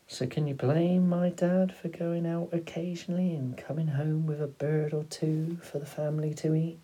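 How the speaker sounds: pleading father. A narrator's reading. Father defending his own dad to his son, pleading.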